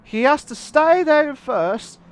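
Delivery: high, loud calls climbing to a yell, then urgent commentary; excited shouting. Real, not acted. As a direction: erupt at the overtake and stay fired up. Commentator shouts as lead changes.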